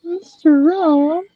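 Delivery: slow, high questioning little voice; drawn-out high echo of the name; toy voice. Shown as a demonstration. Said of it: "You can go".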